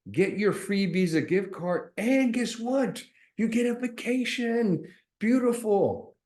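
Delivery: high, hammed-up sarcastic delight; mock excitement. Real, not acted. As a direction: sell the fake prize with sarcasm. Mocking the scam ad's offer.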